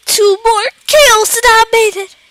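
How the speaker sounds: high, loud burst of hope; gasp, then cry it out, high and loud; loud excitement. Real, not acted. Gamer two kills from the goal, excited.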